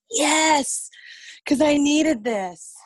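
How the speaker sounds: high, loud, gleeful cheer, drawn out slowly; gleeful yes. Retold or imitated. Coworker retelling her relief to the group, high loud glee. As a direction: cheer like a weight just lifted. Relieved the trainee skipped work.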